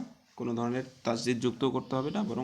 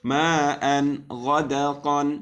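slow chanted recitation, louder; teacher chanting a verse for students; Quran recitation; 2 semitones higher, 8 dB louder, much slower than usual. Shown as a demonstration. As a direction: draw out খলাকনাকুম as in tajweed. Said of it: "teacher demonstrates recitation".

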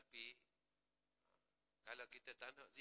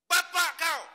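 "Bapa kau!" shouted much higher and louder, as an insult; angry shout; 23 dB louder, slower than usual. Shown as a demonstration. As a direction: bark "Bapa kau!" like a furious curse. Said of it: "dia orang panggil intonasi".

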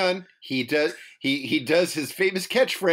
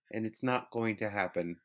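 low, quiet, dejected admission; softly downcast, voice sunk low; sad; 10 semitones lower, 14 dB quieter than usual. Real, not acted. Co-host admitting the story upsets them, sad. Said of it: "It makes me sad".

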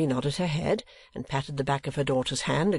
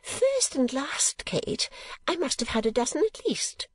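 high, self-satisfied boast; complacent; 11 semitones higher than usual. A narrator's reading. Mother counting her old suitors, high and complacent. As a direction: boast slowly in a high, pleased voice. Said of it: "with a smile of wonderful complacency".

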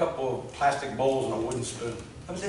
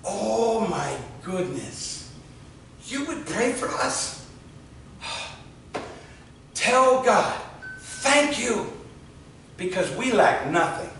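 slow, high, awed voice of a grateful mother; joyful amazed woman; 5 semitones higher, much slower than usual. Retold or imitated. Pastor voicing the grateful mother, slow and high with wonder. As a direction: voice her overwhelmed gratitude, slow and lifted. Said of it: "She just beamed with joy".

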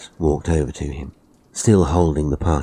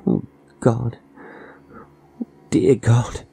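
higher, halting, frightened plea; fearful dread; 6 semitones higher than usual. A narrator's reading. Frightened old resident dreads tonight's visitors, fearful. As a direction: let dread creep in between the words.

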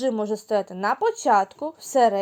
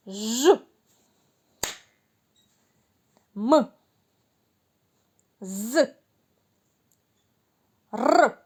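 each sound called out louder, alone; isolated sounds; 4 dB louder, slower than usual. Shown as a demonstration. Teacher calls sounds for a clapping game. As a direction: call each letter sound clearly and loud. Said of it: "гру «Упіймай звук Ж»".

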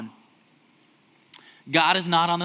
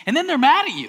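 ordinary talk

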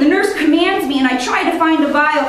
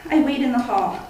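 usual speaking voice

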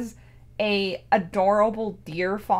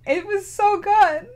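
crying again; 9 semitones higher, 4 dB louder than usual. Real, not acted. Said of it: "I'm crying again".

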